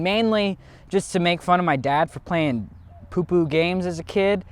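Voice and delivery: usual speaking voice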